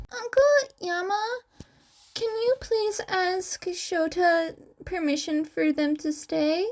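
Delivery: put-on high, pleading kid voice; a little boy begging his uncle; small boy begging his uncle to let the cats stay, pleading; pleading child. A narrator's reading. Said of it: "Izuku said pleadingly".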